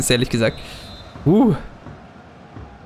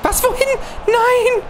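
Was, wohin? Nein! yelped much higher in disbelief; shocked outcry. Real, not acted. Gamer yelps when his pass goes astray, high and shocked. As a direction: cry out at the wild pass. Ball flies off in game.

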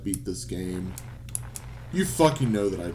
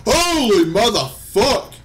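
high, loud disbelieving shriek with laughter; ecstatic scream; 9 dB louder than usual. Real, not acted. Gamer shrieking and laughing after clearing a jump, ecstatic. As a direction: scream with joy at the screen.

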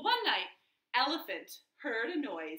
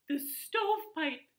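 alarmed; 7 semitones higher, 4 dB louder than usual. Acted. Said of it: "gasped the third little javelina".

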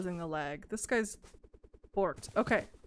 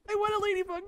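high whiny plea; 10 semitones higher, much faster than usual. Real, not acted. She begs for the ladybug, high and whining.

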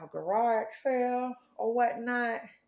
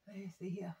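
whisper; 3 semitones lower, 11 dB quieter, faster than usual. Real, not acted. Muttering to self while parking.

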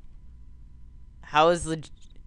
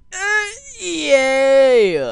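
high, loud yay of delight; squealing cheer; 12 semitones higher, 9 dB louder than usual. Real, not acted. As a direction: cheer like you just won. Cheering a made jump.

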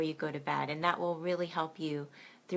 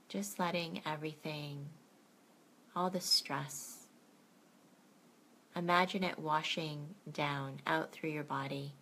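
gentle bedtime meditation guide; guided meditation; 4 dB quieter than usual. Acted. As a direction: ease the listener into calm, softer as it goes.

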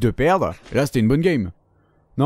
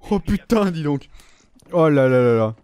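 ordinary talk, nothing marked

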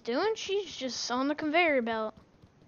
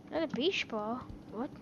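ordinary delivery

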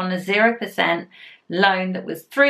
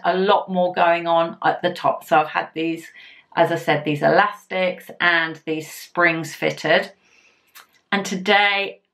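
talking with stretched mouth; lips pulled apart by fingers; 4 semitones lower than usual. Real, not acted. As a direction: fingers hooked in cheeks. Seen 2: Fingers pull mouth corners apart to show braces while talking.

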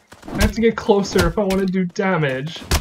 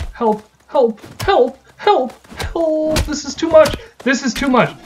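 panicked; 7 semitones higher, 4 dB louder than usual. Real, not acted. Overwhelmed by mobs in game.

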